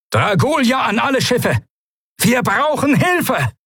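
high, urgent emergency broadcast; shouted distress call. Acted. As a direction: shout for help, drawing out the final plea. Notruf an alle Schiffe. (dringend geschriener Notruf)

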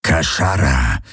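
tiefes, bedrohliches Raubtierknurren; Knurren. A narrator's reading. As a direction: das fremde Wort tief knurren. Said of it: "knurrte er". (tief geknurrt wie ein Raubtier)

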